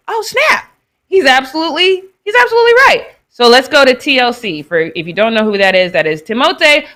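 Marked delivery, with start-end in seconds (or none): 0.1-3.1 like a lightbulb just went on; sudden realization. Retold or imitated. Recalling her friend was right, surprised and high. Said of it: "and I was like".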